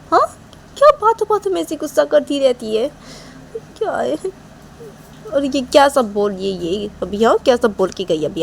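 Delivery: hurt Manisha. Acted. Manisha complains alone, changed voice.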